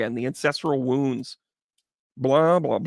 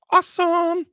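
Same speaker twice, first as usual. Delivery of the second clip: squeaky awesome. Real, not acted. Echoing awesome.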